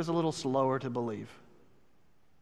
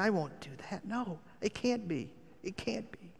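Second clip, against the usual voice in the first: hushed disbelieving thought; 3 semitones higher, 11 dB quieter than usual. Acted. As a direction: murmur it to yourself in disbelief. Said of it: "imagine him thinking".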